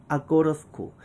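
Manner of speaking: "아꼬러스쿠" run together as one word; "I go to school" reduced to "ah-go-ruh-skool". Shown as a demonstration. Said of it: "이렇게까지 가는 거예요". ("아꼬러스쿠"로 한 단어처럼 이어서)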